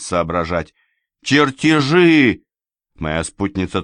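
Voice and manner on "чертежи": high, loud, drawn-out word; exasperated shout. A narrator's reading. Companion exasperated with the dazed narrator, loud shout. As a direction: shout it slowly, out of patience. Impatient companion, stamping her foot.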